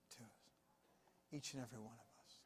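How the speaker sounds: a hushed, intimate whisper to the church. Real, not acted. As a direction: breathe it as a soft whisper. Drops to a whisper.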